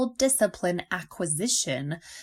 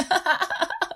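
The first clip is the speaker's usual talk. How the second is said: high laugh; 11 semitones higher than usual. Real, not acted. Laughing at own story.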